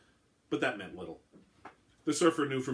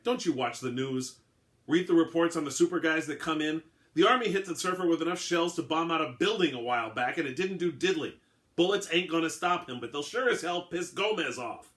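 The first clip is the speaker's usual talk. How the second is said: raised, higher, heated scolding; angry rebuke; 6 semitones higher, 5 dB louder than usual. A narrator's reading. Billinghurst turning angrily on the lieutenant, raised scolding. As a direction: chew him out for the shooting. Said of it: "Turning angrily on DiFilippo".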